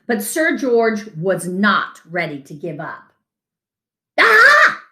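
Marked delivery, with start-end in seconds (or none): 4.2-4.8 excited ah. Acted. Knight finds answer in book.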